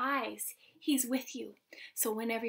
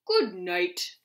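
daddy bear; 4 semitones lower, 6 dB louder than usual. A narrator's reading. Daddy bear says good night.

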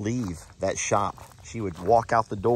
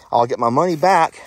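ordinary talk

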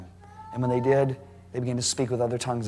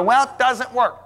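complaining; 9 semitones higher, 5 dB louder, slower than usual. Retold or imitated. Quoting complaining doubters.

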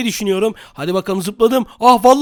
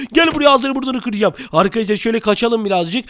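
ordinary talk

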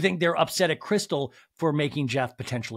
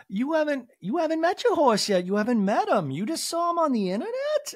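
Jennifer Tilly parody; 12 semitones higher, 3 dB louder than usual. Retold or imitated. Jennifer Tilly impression resumed.

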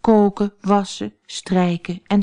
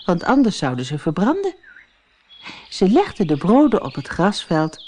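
usual speaking voice

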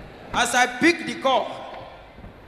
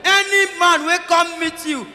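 put-on high, loud warning shout to the girls; 7 semitones higher, 7 dB louder than usual. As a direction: yell it out as a warning.

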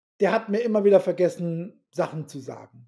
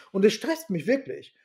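usual speaking voice

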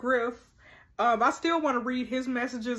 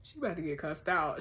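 lower, softer aside of a threat; low-key warning; 6 semitones lower, 6 dB quieter than usual. Real, not acted. Reacting to the childminder's message, quiet low warning. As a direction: say the threat low and under control. Threat first muttered, then escalated.

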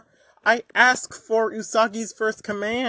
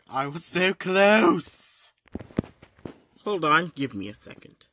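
ordinary delivery

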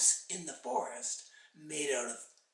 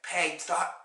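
ordinary delivery, nothing marked

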